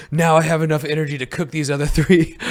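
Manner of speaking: mock-solemn survival host line; mock Bear Grylls. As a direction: lightly imitate a deadly serious TV survivalist. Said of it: "he goes".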